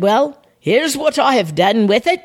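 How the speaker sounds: hammed-up, horrible Australian accent; badly imitated Aussie voice. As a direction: attempt an Australian accent and miss. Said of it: "That was a horrible accent".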